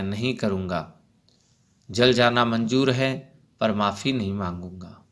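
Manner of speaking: ordinary delivery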